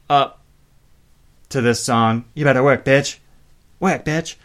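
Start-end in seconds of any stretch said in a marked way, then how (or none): 2.3-4.4 lightly imitated bad British accent; a touch of terrible put-on British; mock British accent. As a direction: quote the lyric in a fake British accent. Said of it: "transparently terrible British accent".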